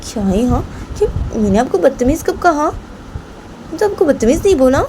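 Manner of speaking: high-pitched protest of innocence; protesting Rahma. Acted. Rahma denies insulting the grandmother, protesting.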